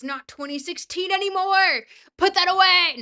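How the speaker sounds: hammed-up high shout, building in volume; mock scolding. Streamer tells chat off over dabbing, mock-outraged shout.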